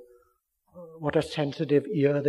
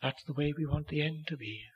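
ordinary talk, nothing marked

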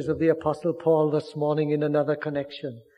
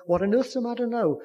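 higher, quick mock-puzzled husband; exasperated father; 8 semitones higher, 3 dB louder, faster than usual. Father asking wife why she dashed out, lightly mock-puzzled. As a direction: toss it off like a baffled dad. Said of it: "you'll say to them".

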